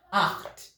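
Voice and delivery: "Acht" said breathy, with a throaty ch; model word for repeating. Shown as a demonstration. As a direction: model Acht with the guttural ch sound. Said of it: "we pronounce it as acht".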